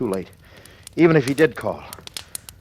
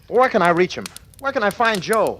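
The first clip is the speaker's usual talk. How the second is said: desperate; 10 semitones higher, 4 dB louder than usual. Acted. Desperate call searching for Joe.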